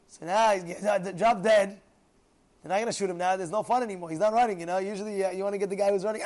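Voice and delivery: ordinary talk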